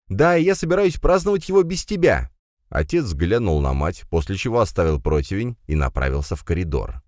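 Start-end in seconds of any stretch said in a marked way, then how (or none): 0.1-2.3 high, raised retort; irritated rejection. A narrator's reading. Firs refuses guest at his birthday, irritated. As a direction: throw the retort back, annoyed.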